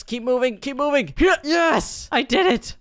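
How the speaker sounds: high, excited urging that breaks into a cheer; urgent self-cheering into triumph. Real, not acted. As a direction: push yourself on, then celebrate. Urging self on, then succeeding.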